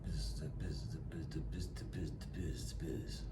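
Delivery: breathy low mumbling of biz biz; imitating the low-talking crew; whispered mumble. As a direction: whisper the syllables lazily. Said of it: "people that's talking low".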